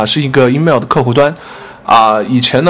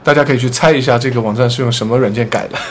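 ordinary talk

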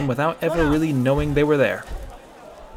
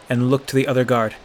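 usual speaking voice

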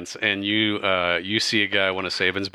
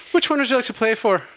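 high, quick showy female voice; bright lilting gambler voice, raised high; Kalora speaking; 13 semitones higher, 4 dB louder, faster than usual. Acted. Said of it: "and she says".

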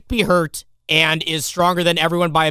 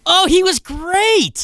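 gushing; 14 semitones higher, 4 dB louder, much slower than usual. Real, not acted. Gushing defense of Affleck.

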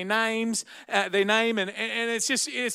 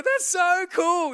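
gleeful dad; 11 semitones higher, 4 dB louder, slower than usual. Retold or imitated. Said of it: "And you go".

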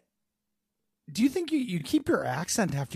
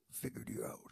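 Batman whisper; 15 dB quieter than usual. Acted. Batman gloats at the Riddler.

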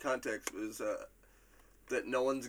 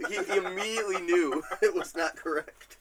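higher, laughing through the words; 7 semitones higher, 3 dB louder than usual. Real, not acted. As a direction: crack up midway through talking. Amused at the wrong guess.

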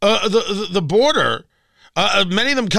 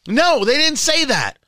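normal talking voice

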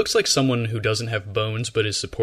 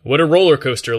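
loud exclamation; 5 semitones higher, 9 dB louder than usual. Real, not acted. Mock dramatic remark.

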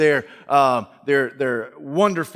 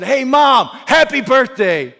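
loud, higher, bright greeting at the door; cheerful son; 10 semitones higher, 7 dB louder, slower than usual. Acted. Son greeting his mother on her birthday, loud and cheery. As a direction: call out happy birthday to mom.

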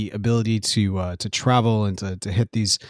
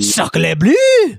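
hammed-up French accent, higher and louder on Sacre bleu; mock French exclamation; 9 semitones higher, 5 dB louder than usual. Cohost joking about Saint Claude, France, with a mock French cry. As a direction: blurt it like a cartoon Frenchman.

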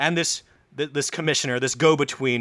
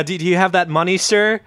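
higher, timid asking voice; meek commissioner; 5 semitones higher, 5 dB louder than usual. Retold or imitated. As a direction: ask for the money like a nervous servant. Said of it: "commissioner being very meek".

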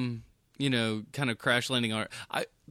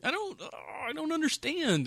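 much higher what the fuck, exasperated; mock baffled outrage. Viewer mocks the voiceover, high exasperated outburst. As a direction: play the lost viewer. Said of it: "and you're like".

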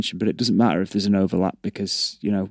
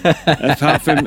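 laughing; 6 semitones higher, 5 dB louder, slower than usual. Real, not acted. Laughing while suggesting Christmas.

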